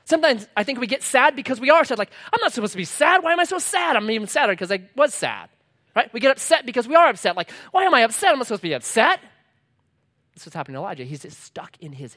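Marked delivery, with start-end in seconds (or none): 2.3-5.5 high, exaggerated fretting; mock self-pity. Preacher mimicking someone sad about being sad, high mock fretting. 7.7-9.2 high, loud parody of fretting; mock upset. Preacher mimicking someone upset at being upset, high mock protest.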